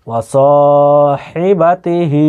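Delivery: স and the last হি held long; drawn-out vowels. Shown as a demonstration. Teacher showing where to stretch, স and last হি drawn out. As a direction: stretch স and final হি, keep বা তি short. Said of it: "টানতে হবে।".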